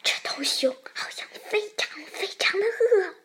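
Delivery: high, excited stage whisper. A narrator's reading. The rabbit tells Xiaopang the bear seems hungry, whispering excitedly. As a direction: whisper it urgently, thrilled. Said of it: "兔子压低了声音， 激动地说".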